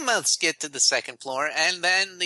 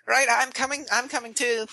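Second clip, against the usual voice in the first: high, eager little voice; 11 semitones higher than usual. Acted. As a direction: rush to join them. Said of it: "gets up and says".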